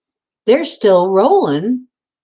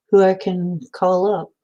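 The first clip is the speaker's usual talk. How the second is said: usual speaking voice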